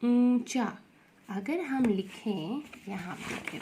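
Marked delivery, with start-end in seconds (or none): 0.0-0.8 ऊंचा with a loud, held nasal first vowel; ऊंचा, first vowel clearly nasalised; chandrabindu nasal. Shown as a demonstration. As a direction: hum the ऊं through the nose, then चा. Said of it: "इसका उच्चारण आप देखिए कैसे है".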